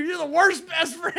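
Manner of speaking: high, slow, laughing shout; laughing scold. Retold or imitated.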